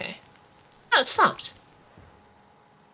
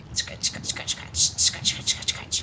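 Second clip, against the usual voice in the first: whispered scrubbing; slower than usual. Pretend. Mock scrubbing in shower.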